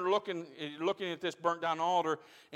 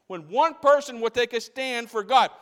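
loud, higher, leaning on one; preaching climax; 5 semitones higher, 8 dB louder than usual. Real, not acted. Preacher exhorting the church to stand, loud and high. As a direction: rouse the congregation.

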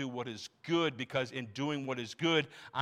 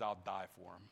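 much softer and lower, hushed; hushed vow; 7 semitones lower, 9 dB quieter than usual. Acted. Pastor voicing Jesus' quiet vow, hushed and low. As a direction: say it almost under your breath. Jesus vows to die for them.

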